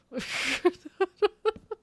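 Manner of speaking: giggling at saying mate; high laugh. Real, not acted. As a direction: burst of high-pitched laughter. Laughing at own habit.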